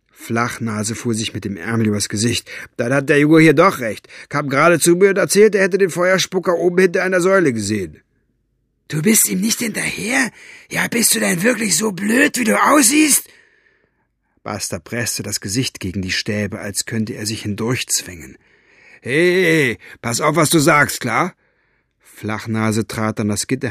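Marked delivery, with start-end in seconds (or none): 2.8-8.0 louder, higher, quick excited report; animated guard putting it together; lightly voiced henchman. Figure of henchman Flachnase speaks. 8.9-13.3 high, strained, incredulous scolding; exasperated villain at the end of his patience; furious Basta. Acted. Basta berates his guard. 19.0-21.3 louder, higher warning shot; offended henchman pushing back; annoyed warning. Acted. Guard warns insulting prisoner.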